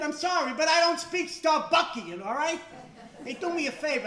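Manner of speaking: mock him loudly with fake politeness; sarcastic yell.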